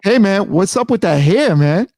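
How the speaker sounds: ordinary talk, nothing marked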